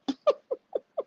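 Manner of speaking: squealing high laugh. Real, not acted. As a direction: burst out in a high giggle. Laughs at own story.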